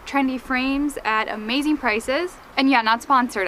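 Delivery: ordinary delivery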